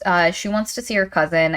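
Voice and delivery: ordinary delivery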